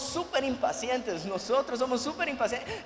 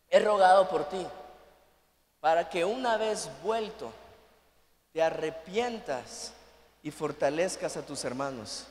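tender scripture quote; 6 semitones lower than usual. Acted. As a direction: read the words of Jesus gently. Quoting Jesus softly.

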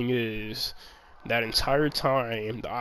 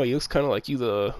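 usual speaking voice

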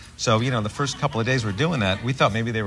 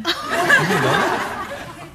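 laughing disbelief; 3 dB louder, much slower than usual. Retold or imitated. Retelling shocked disbelief.

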